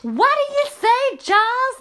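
high, loud Geordie shout; accidental hammed-up Geordie. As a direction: call out in broad Geordie as if deafened. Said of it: "I've gone Geordie now".

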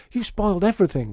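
high, loud, rushed accusation; upset cry. A narrator's reading. Lydia accusing Mister M of spoiling Christmas, crying out. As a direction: cry out as a distraught child. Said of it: "cried Lydia".

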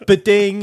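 high, loud sung ding-dong; notification chime. Retold or imitated. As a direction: chirp it like a phone alert. Imitating a bank app chime.

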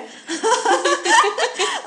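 high giggle of nerves; nervous laugh. Real, not acted. Nervous laugh at interview start.

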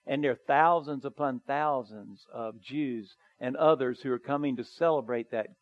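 ordinary talk, nothing marked

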